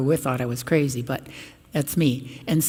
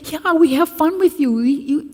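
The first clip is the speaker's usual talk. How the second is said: put-on eager kids; 11 semitones higher, 5 dB louder than usual.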